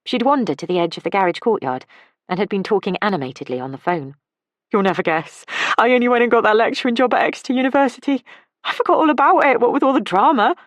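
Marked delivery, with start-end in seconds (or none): 4.7-10.5 high, fast and loud with excitement; bubbling over with big news; excited. A narrator's reading. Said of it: "jumping up and down with excitement".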